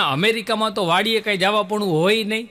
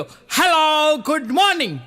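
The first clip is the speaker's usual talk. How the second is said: foreigner's greeting; 8 semitones higher, 4 dB louder, slower than usual. Retold or imitated. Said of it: "ઓલો ભૂરીઓ બોલ્યો".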